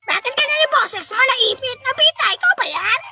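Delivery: high, squeezed mimicking voice; pinched squeaky voice. Host questioning Boji about his voice, pinched squeaky mimicry. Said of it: "Ipit na rin yung boses ko".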